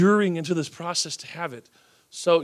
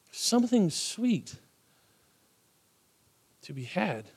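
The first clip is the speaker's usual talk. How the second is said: soft savoring; 6 dB quieter, slower than usual. Shown as a demonstration.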